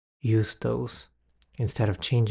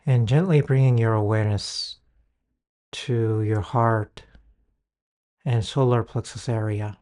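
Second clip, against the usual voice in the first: guided meditation. Acted. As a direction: ease the listener into calm.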